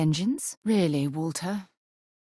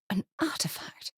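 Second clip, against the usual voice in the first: soft unvoiced breath of awe; awed whisper; 4 semitones higher, 6 dB quieter than usual. Companion revealing the artifact at the core, awed whisper. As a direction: breathe it out in wonder.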